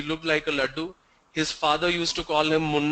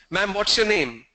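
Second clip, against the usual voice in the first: normal talking voice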